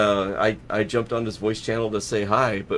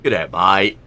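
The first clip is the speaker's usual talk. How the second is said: Aussie greeting; 12 dB louder than usual. Shown as a demonstration. Streamer retrying his Australian greeting for a viewer. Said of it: "The second one was better".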